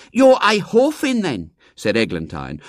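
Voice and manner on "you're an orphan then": put-on high, loud, know-all girl; worldly knowingness. A narrator's reading. Eglantine informing William, high and knowing. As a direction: pronounce the verdict as a worldly expert. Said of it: "with an air of wide knowledge of the world".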